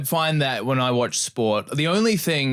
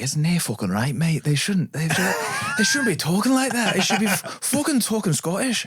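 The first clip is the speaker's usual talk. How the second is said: mock drunken rant, pitched higher in a put-on Scottish voice; drunk, pissed-off Scot; 8 semitones higher than usual. Drunk Scottish PE teacher ranting about the kids, mock angry. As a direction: rant like the boozy PE teacher fed up with English accents. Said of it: "so pissed off".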